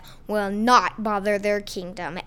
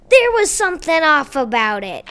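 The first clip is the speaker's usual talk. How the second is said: loud; 5 semitones higher, 7 dB louder than usual. Acted. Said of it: "yelled Pizza Man".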